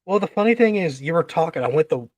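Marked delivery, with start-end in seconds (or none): none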